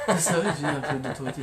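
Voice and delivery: playful mock Italian mafia accent, laughing through it; a laughing hint of an Italian mafia boss. Co-host teasing about family talk, mock Italian mafia accent. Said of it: "on aurait dû prendre l'accent, euh, mafieux".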